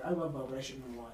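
ordinary talk

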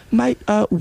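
high mock English accent. Comic playing English local approaching, high English accent. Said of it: "Oh, they're like".

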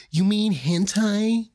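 slow, drawn-out weeb voice; excited anime fan. Pretend. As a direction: ham up an anime nerd. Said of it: "Let me get my weeb voice".

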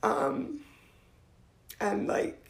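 fighting back real tears while talking; apologizing through real tears; crying. Real, not acted. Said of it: "I'm gonna compose myself".

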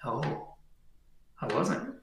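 very soft, half-whispered confession; hushed admission. Acted. Man admitting to the entity, hushed and whispered. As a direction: breathe it out as a sinking realization.

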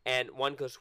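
ordinary delivery

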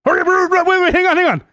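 high, pleading salesman hurrying you; squeaky frantic salesman begging you back. Retold or imitated. Gym salesman calling after a leaving customer, high and frantic.

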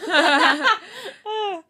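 breaking into laughter; words through giggles; laughing. Real, not acted. Cracks up mid-sentence.

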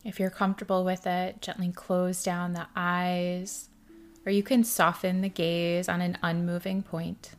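soft, hushed meditation guide with long pauses; guided meditation. Acted. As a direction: ease the listener into stillness, softly and unhurried.

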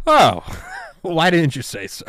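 sarcastic. Retold or imitated. Sarcastic reply to wish.

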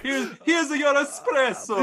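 high, slow Italian barista parody; mock Italian accent. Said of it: "They're like".

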